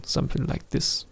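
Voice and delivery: breathy whispered joke; whispered aside. Real, not acted. Joking aside about bad plugin choice.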